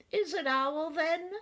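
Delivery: high, mildly nervous rabbit; nervous gulp. A narrator's reading. Rabbit wondering who knocks, nervous. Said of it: "Rabbit gulped".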